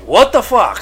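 loud, high shocked yell; shocked outburst. Retold or imitated. Imitating friend's shock at the movie, loud high yell. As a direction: blurt it out in disbelief. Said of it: "until you're like".

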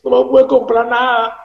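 hammed-up crybaby complaint; mock whining. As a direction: whimper like a cheated passenger. Mocks sad fake flight passenger.

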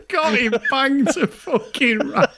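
slow, high words through real laughter; laughing. Real, not acted. Gloating to co-host over catching his mate, laughing. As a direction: gloat while still cracking up. Still cracking up after punchline.

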